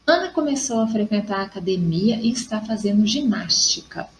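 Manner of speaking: ordinary talk, nothing marked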